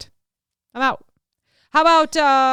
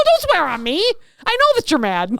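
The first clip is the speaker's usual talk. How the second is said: high, loud mock indignation; playful outraged retort; retold indignation; 8 semitones higher, 4 dB louder than usual. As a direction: object hotly, pitched up, as in a retold spat. Retold quarrel with Steve.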